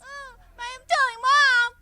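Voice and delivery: loud bratty yell at a sibling; a child bawling that he'll tell his mom; kid yelling threat. Acted. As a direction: shout it like a tattling kid.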